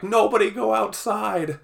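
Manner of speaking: high, loud mock-panicked shout. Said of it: "and we're like".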